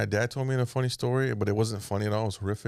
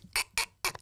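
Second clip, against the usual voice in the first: voiceless wheezing laugh; mom's imitated laugh; 6 dB quieter than usual. Son showing how his mom laughs, voiceless wheeze. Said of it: "My mom laughs like that, like".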